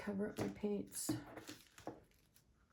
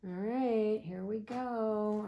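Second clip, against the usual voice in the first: slow, louder, drawn-out go; nervous excitement; 2 semitones higher, 7 dB louder, much slower than usual. Real, not acted. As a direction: brace yourself and stretch it out. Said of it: "I always get nervous".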